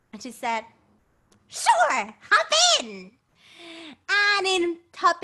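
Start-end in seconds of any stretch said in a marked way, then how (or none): 1.5-3.2 witch voice. Acted. The witch answers.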